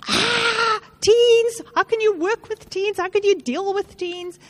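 high-pitched, hammed-up dread; imitating panicked adults, high and exclaiming; mock horror. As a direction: react as if teens are a terrifying ordeal. Mimics people dreading teenagers.

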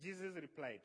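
ordinary talk

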